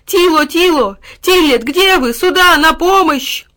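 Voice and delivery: loud, high call to a dog; drawn-out, desperate calling; calling for help. A narrator's reading. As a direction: shout urgently for rescue. Boy calls his dog for help.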